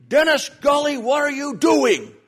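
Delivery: high, loud principal's yell; angry yell. Retold or imitated. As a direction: bellow it like a furious principal down a hallway.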